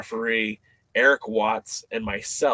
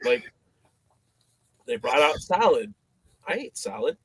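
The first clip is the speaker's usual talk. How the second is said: ordinary delivery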